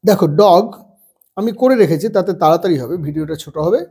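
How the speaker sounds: ordinary delivery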